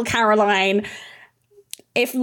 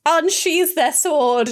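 normal talking voice

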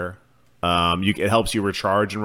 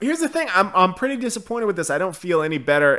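much higher, joking helium-balloon voice; 9 semitones higher, 3 dB louder than usual. Pretend. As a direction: pitch way up like sucked helium. Jokes about voice after oxygen hits.

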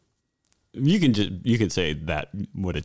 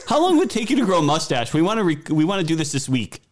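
high, hurried client imitation; mock-urgent, higher and faster; mock casting director; 10 semitones higher, 5 dB louder, faster than usual. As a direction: rush it like an impatient producer. Imitates casting people's absurd request.

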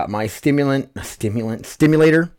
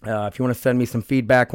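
ordinary talk

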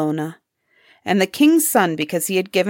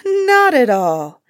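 dismissive; 5 semitones higher, 4 dB louder, slower than usual. Acted. Villain brushes off Osmo's fear.

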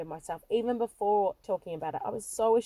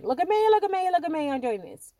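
much higher, loud mock "look at me"; louder show-off kid; 7 semitones higher, 4 dB louder than usual. Young her demanding the limelight, loud hammed-up show-off. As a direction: crank the spotlight-hungry child right up. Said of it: "you know, again".

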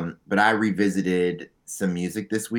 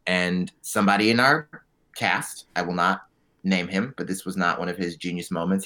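normal talking voice

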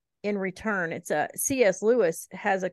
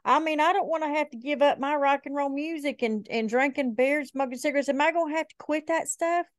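higher-pitched imitation of a girl; girl's voice; 7 semitones higher than usual. Girl worried about giving up rock and beer, imitated higher. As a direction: voice a reluctant girl, pitched up throughout. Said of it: "a girl ask me one time".

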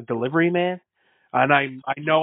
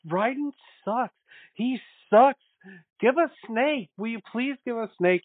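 mock fan whining; 9 semitones higher than usual. Mocking fans whining about Raiden.

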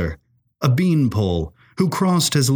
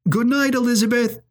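calling up from far below, much higher; faint call from below; 13 semitones higher, faster than usual. A narrator's reading. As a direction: shout it up a stairwell from a distance. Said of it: "faintly call up".

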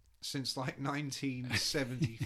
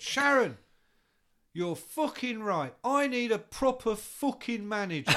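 put-on higher, louder drunken [name]; [name] impression; 7 semitones higher, 7 dB louder than usual. [name] announcing he'll call Sharon, high and loud. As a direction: bellow it like a wasted rock star.

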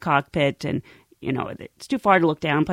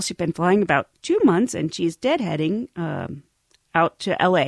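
ordinary talk, nothing marked

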